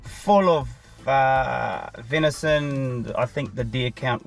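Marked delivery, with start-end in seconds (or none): none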